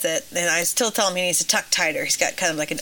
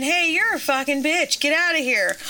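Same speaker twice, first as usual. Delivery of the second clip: higher-pitched mock put-down; retold older kid; 7 semitones higher than usual. Boy quoting the older kid at the pool, higher mock taunt. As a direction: voice an older kid's insult, pitched up. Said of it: "Yeah, and the older kids".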